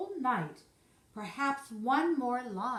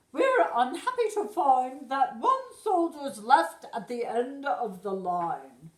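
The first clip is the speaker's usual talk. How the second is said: loud, haughty royal complaint; displeased queen; 3 semitones higher, 7 dB louder than usual. Acted. The queen complains to her troops, loud and haughty. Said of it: "The queen wasn't pleased".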